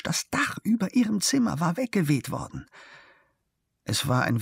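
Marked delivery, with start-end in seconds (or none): 0.0-2.7 high-pitched, agitated twitter; excited chirping. A narrator's reading. Engineer's wife reports the storm damage, chirping excitedly. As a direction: chirp it high like a startled bird. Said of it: "erregt zirpte sie auf Russisch".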